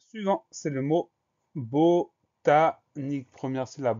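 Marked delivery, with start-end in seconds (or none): 1.5-3.3 "botanique" very slow, louder, in separate syllables. Shown as a demonstration. Teacher announcing the next spelling word, "botanique" slowly syllabified. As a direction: dictate "botanique" one drawn-out syllable at a time.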